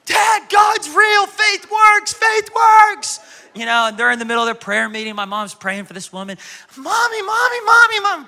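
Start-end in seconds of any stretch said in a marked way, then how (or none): 0.1-3.2 put-on high, loud excited child's yell, slow on the first words; child shouting. Little girl running to tell her dad that faith works, shouting. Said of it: "I'm shouting". 6.8-8.3 put-on high, pleading little-kid voice growing louder; insistent child calling. Little girl tugging her praying mom's dress, calling high and insistent.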